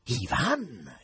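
plain reading voice

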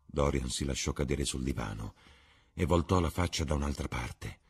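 ordinary delivery

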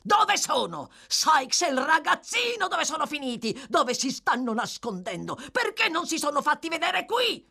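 high, loud barrage of frantic questions; furious yelling. Acted. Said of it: "battendo furiosamente i piedi sul pavimento".

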